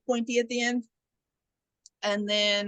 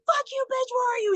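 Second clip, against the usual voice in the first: mock outrage; 14 semitones higher than usual. Killer on the phone to hiding victim, mock furious. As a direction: imitate a frantic, high-pitched angry demand.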